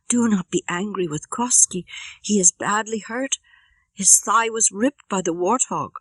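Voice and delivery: put-on a girl greeting her rescuer, then defending Koski; young girl's voice. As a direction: speak up as an eager young girl. Lakwit speaks to Maina.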